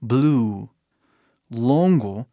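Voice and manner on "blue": BLUE with a long U; stretched vowel. Shown as a demonstration. Teacher corrects the short version, stretching BLUE. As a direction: hold the U in BLUE. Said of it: "Preciso esticar o som".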